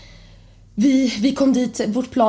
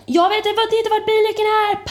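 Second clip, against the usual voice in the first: high, frantic mum at a loss; chaotic panicking mum; 12 semitones higher than usual. Retold or imitated. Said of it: "kaotisk mamma".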